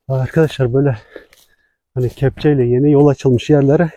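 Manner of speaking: ordinary delivery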